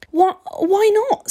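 high, stammered, upset question; tearful. A narrator's reading. Steve rejected by the vampire, high and tearful. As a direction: stammer it high, starting to cry. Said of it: "He had started to cry".

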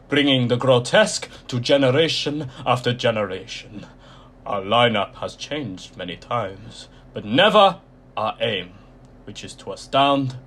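loud, croaky ringmaster announcement; croaky showman voice. A narrator's reading. Mister Tall welcomes the audience to his freak show, loud and croaky. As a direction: proclaim it like an ancient circus master, loud. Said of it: "his voice was low and croaky".

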